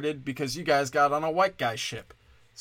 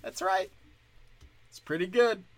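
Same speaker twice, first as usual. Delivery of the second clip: smug approval; 7 semitones higher than usual. Retold or imitated. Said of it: "Isn't there a little bit of like".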